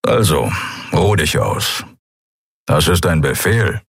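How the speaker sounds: lightly gruff male character; understated gruff command; grumbled reassurance. A narrator's reading. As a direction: grumble it as a kindly order. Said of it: "brummte er".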